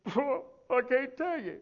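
high, slow blubbering imitation; mock tearful. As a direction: imitate a man too choked up to answer. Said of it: "just sitting there bawling".

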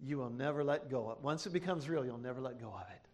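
low, softened preaching voice; solemn testimony. Real, not acted. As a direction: drop low and quiet, confiding a deep conviction. Hushed, solemn testimony to congregation.

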